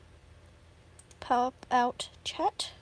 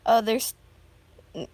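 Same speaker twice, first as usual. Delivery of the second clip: usual speaking voice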